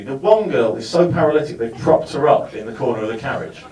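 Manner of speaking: ordinary talk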